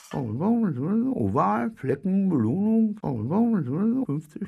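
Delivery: high-pitched newsreader voice. A narrator's reading. Newsreader announces a missing egg, high announcer voice. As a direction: pitch it high, like bulletin snatches from the television. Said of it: "Was sagte die Nachrichtensprecherin da?".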